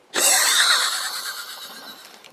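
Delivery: shrieking high cackle; very high, wild evil cackle; maniacal laugh. Acted. Villain laugh.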